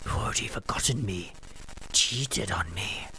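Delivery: bitter accusing whisper from a ghost; ghostly whisper. Acted. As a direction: whisper the betrayal coldly.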